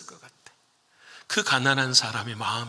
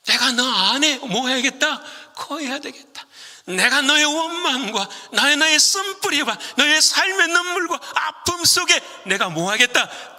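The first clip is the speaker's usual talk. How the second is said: much higher and louder, building to a peak; full-force preaching; 11 semitones higher, 4 dB louder than usual. Acted. Pastor crying out Jesus' promise to the congregation, loud and high. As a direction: preach it at the top of your voice.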